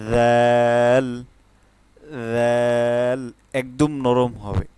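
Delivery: slow, drawn-out জাল, tongue tip soft; soft dhal. Shown as a demonstration. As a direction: let জাল flow softly from the tongue tip. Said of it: "জাল হচ্ছে সবসময় নরম থাকবে".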